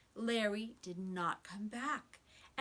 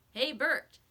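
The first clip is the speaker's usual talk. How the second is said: louder call across the shop; 4 semitones higher, 7 dB louder than usual. A narrator's reading. Mechanic calling to Bert inside the shop, louder. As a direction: shout to a workmate inside. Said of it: "called to someone in the shop".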